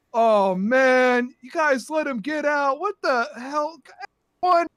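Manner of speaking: loud, high-pitched complaint; exasperated steward. Acted. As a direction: wail in exasperation at the staff. Upset steward confronts staff.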